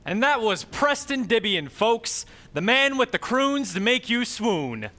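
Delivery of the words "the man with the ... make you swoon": normal talking voice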